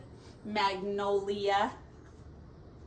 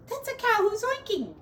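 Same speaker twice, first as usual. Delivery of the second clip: amused exclamation; 11 semitones higher, faster than usual. Real, not acted. Amused aside to children.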